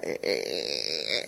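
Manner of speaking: low, soft rubbery creak from the throat; imitated stretching sound. Telling how rubber tubing sounded as it stretched, imitated creak. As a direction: imitate slingshot tubing being pulled tight.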